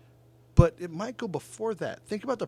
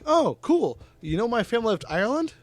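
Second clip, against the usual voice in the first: much higher, louder, sarcastic bright comeback; mock chirpy retort; 8 semitones higher, 5 dB louder than usual. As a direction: fire back with fake cheer, quick. Bar argument retold.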